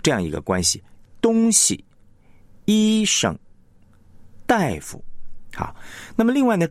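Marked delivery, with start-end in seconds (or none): 1.2-5.0 东, 医, 大 held full, with 西, 生, 夫 light after them; stress on first syllable. Shown as a demonstration. Teacher repeats examples of front-stressed words, first syllable leading. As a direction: hit the first character of 东西, 医生, 大夫. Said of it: "一般呢是重点在前".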